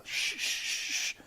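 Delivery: repeated breathy shh; a string of urgent shushes; crowd shushing. Acted. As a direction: hush everyone around you. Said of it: "everybody who's been behind him, they just go".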